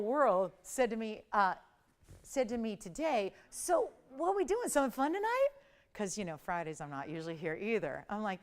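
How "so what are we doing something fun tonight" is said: higher, lilting question quoting a friend; friend's eager question. Retold or imitated. Host quotes best friend asking about plans, high and expectant.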